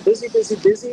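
sing-song busy, busy, busy; mock busy warning. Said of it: "they were saying".

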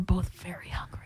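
breathy stage whisper. Real, not acted. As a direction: whisper it like a secret. Confiding aside on air.

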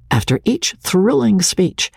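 ordinary delivery

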